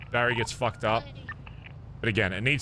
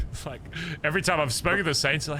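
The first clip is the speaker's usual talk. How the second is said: ordinary talk, nothing marked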